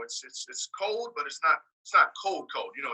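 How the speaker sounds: ordinary delivery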